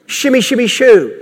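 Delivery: sing-song fake babble; mock tongues babble. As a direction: parody of made-up tongues. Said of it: "to mimic".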